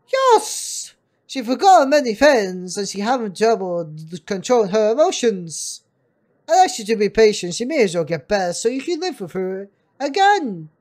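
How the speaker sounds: loud, hammed-up doctor voice. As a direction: project loudly, starting with a high burst. Plays the doctor.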